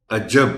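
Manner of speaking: عجب with weight on the j, aj-JAB; stress on jeem. Shown as a demonstration. Teacher modelling عجب, leaning on the second syllable, a-JJAB. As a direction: press on the ج in عجب, loudly. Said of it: "جیم پر تھوڑا سا زور".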